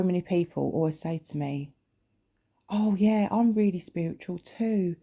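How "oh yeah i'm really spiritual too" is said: higher-pitched mimicking of a keen claimer; eager wannabe spiritual type. People telling her they are spiritual too, mock eager. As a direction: imitate someone chiming in, pitched up, pleased to claim it.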